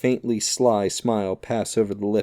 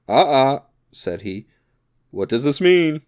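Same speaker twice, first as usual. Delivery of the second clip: high, loud "Ah, ah" of astonishment; startled big musketeer demanding answers; astonished exclamation; 6 semitones higher, 6 dB louder than usual. A narrator's reading. Porthos finds his duel rival here, loudly astonished. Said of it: "stood quite astonished".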